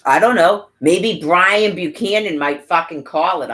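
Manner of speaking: loud, furious yelling; erupting in a real rant; angry outburst. Real, not acted. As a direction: blow up at them. Ranting at agency, sudden outburst.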